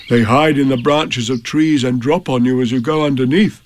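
lightly voiced simple bear; a hint of an earnest bear; Pooh's voice. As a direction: explain it earnestly, with a slight character voice. Pooh's figure voice.

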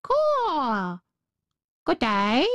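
drawn-out caw, then a crow's greeting; talking crow. A narrator's reading. Crow greets a lone little girl, cawing crow voice. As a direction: voice it as a crow, starting with a high caw. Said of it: "nodded its head and said".

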